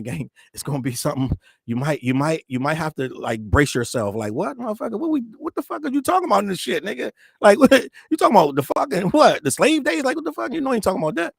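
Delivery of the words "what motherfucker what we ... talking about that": outraged fan. Retold or imitated. Imagined fans' outraged reaction.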